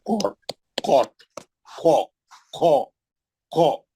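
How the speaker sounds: कॉट and खो with a full, rounded heavy vowel; thick, round-mouthed. Shown as a demonstration. Teacher models the heavy letter for students, thick and round-mouthed. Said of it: "गोल मुंह से".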